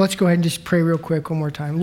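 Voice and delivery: usual speaking voice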